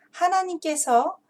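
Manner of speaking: plain reading voice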